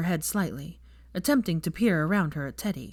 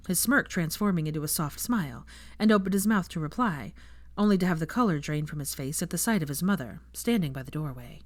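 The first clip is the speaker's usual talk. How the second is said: ordinary delivery, nothing marked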